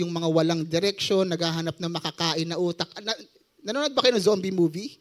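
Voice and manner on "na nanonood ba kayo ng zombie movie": ordinary talk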